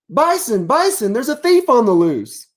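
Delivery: high, urgent cartoon deer calling for his friend; upset deer. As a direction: alarm a friend about a theft. Calling to Bison.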